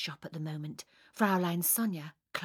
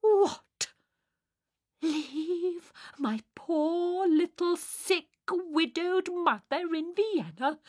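high, drawn-out tragic disbelief; horrified protest; 10 semitones higher, 5 dB louder, slower than usual. Acted. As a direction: echo it back aghast, stretching every pitying word. Sonia is appalled at suggestion.

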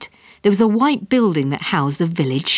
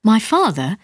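plain reading voice

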